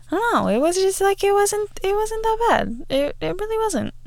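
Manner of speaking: high, squeaky defensive shrug; high, defensive shrug to her mom; sheepish shrug. Retold or imitated. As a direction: brush off mom's horror, bashfully. Retold reply to mom.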